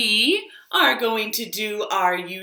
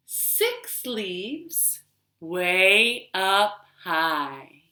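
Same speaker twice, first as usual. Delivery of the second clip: slow singsong counting; counting rhyme; 2 semitones higher, much slower than usual. Acted. Storytime host counting leaves with children, singsong. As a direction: count along slowly with the kids.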